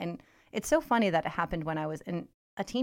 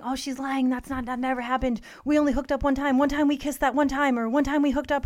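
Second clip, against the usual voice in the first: higher, louder whiny denial; hammed-up cheater pleading his case; imitating the boyfriend's excuses, pitched up; mock boyfriend excuses; 6 semitones higher, 5 dB louder than usual. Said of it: "he had been saying".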